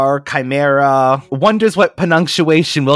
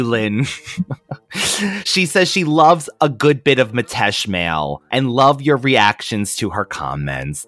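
usual speaking voice

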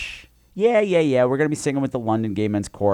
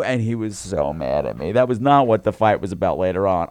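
ordinary talk

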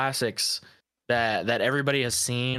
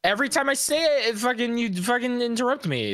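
angry; 6 semitones higher, 3 dB louder than usual. Real, not acted. Heated argument with friend.

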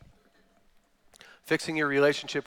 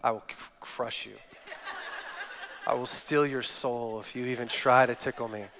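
low mock threat, imitating his wife; threatening wife; 3 semitones lower, 5 dB quieter than usual. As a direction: deliver a comic deadly warning, pitched down. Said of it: "she's like".

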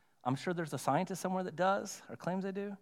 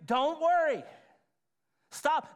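Don't worry, Stop, pitched higher and a bit louder; firm command; 7 semitones higher, 4 dB louder, slower than usual. Acted. Jesus's word to worried listeners, as a firm higher command. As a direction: bark it like an order, not advice. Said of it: "That's not a suggestion".

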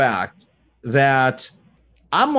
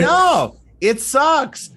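blunt honest answer; 10 semitones higher, much slower than usual. Retold or imitated. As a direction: exclaim it with playful bluntness. Blunt honest answer to "how are you.

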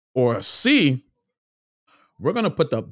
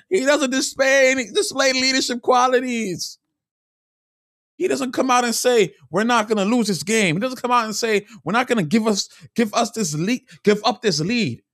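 higher, heated rant; frustrated; 7 semitones higher than usual. Real, not acted. Fan ranting about his team's leader, frustrated and higher. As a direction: vent real frustration at the player. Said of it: "Sorry, I'm a bit frustrated".

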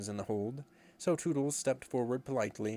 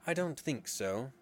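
ordinary delivery

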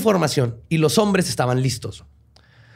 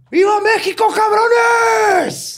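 very high, full-voiced rallying shout; hammed-up patriotic war cry; imitated battle cry; 4 dB louder, much slower than usual. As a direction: yell it out like leading an ambush. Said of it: "al grito de".

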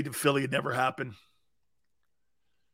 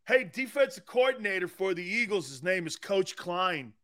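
normal talking voice

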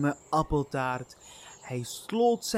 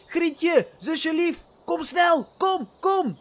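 high, loud calling out; excited shout; 13 semitones higher, 4 dB louder, slower than usual. Acted. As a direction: yell for your sister to come quick. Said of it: "Door het geschreeuw".

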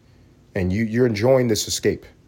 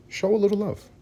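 much higher, pleading; higher coaxing plea; 12 semitones higher than usual. Shown as a demonstration. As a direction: beg sweetly for love. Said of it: "my suggestion is".

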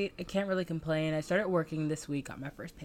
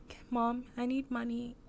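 higher pleading voice; whiny kid asking mom; 6 semitones higher, 7 dB quieter than usual. Retold or imitated. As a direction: beg mom for money.

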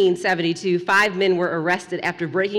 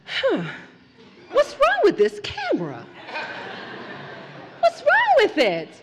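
calm mother; 13 semitones higher than usual. Retold or imitated. Mother calmly asks her child about the broken camera, high and still. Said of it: "she calmly goes".

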